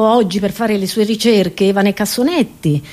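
ordinary talk, nothing marked

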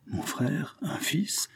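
breathless whisper of disbelief, pitched high; stunned whisper. A narrator's reading. The marquis, stunned to learn his brother had a son, whispering. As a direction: hush it out as if the news took his breath.

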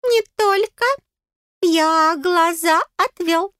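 high, slow little voice; tiny piping fairy, stretching the words; self-important fairy. A narrator's reading. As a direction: announce it in a measured squeak. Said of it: "отозвался фей".

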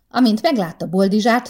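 ordinary delivery

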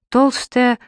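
ordinary delivery

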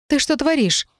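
high-pitched, startled demand; hoarse question. A narrator's reading. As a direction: ask hoarsely, shocked. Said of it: "просипела в его серьёзное лицо".

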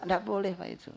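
ordinary talk

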